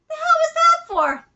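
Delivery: high-pitched, put-out outburst; indignant protest. Acted. Player protesting after an apology, high and indignant. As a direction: snap back high in mock outrage.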